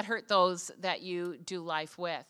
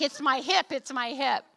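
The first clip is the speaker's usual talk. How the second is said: higher, insistent repeated plea; insistent protest; 6 semitones higher, 4 dB louder than usual. Retold or imitated. Traveler explaining her hip to airport security, insistent protest.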